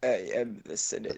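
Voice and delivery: soft, low, smooth villain voice; quiet, low proclamation from a smooth-voiced emperor; understated smooth Sith Lord; smooth Palpatine. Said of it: "I'm the smooth puppy".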